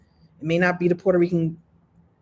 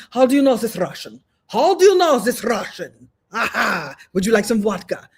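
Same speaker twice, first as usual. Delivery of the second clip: hammed-up Russian accent, a touch louder; mock Russian; 2 semitones higher, 3 dB louder than usual. Russian listener delighted, offering vodka, mock Russian accent. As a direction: play the pleased Russian. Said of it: "to a Russian".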